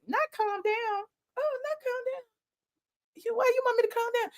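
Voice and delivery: tiny, high, hushed voice; put-on quiet little voice; mock calm. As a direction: shrink to a soft, high mock protest. Mockingly echoing request to calm down.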